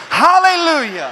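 loud, high hallelujah with the church; full-voiced congregational hallelujah; shouted praise. Real, not acted. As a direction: shout it together as one choir. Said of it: "one more time. Do it with me".

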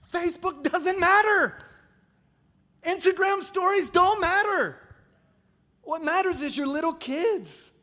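preaching shout. Real, not acted. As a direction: hammer it home to the congregation. Preacher hammering the point home.

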